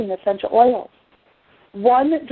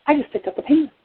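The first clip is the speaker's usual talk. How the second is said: retold amazement; 6 semitones higher than usual. Mother realizes it too.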